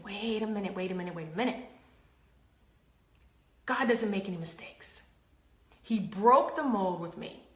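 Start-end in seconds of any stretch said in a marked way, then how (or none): 0.0-1.7 softer, half-whispered wait a minute; hushed realization. Retold or imitated. Realizing God's plan, hushed. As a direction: draw them in with a hush.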